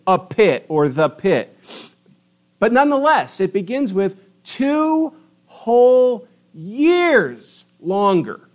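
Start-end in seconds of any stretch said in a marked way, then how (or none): 3.4-7.6 high, very slow, years drawn out; emphasis on two whole years. Shown as a demonstration. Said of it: "There's emphasis there".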